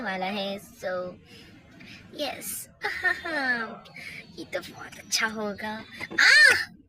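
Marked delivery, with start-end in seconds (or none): 6.2-6.8 much higher, louder sudden aah; a schemer's cry as she falls in her own trap; hammed-up pained yelp. Villain slips on own oil.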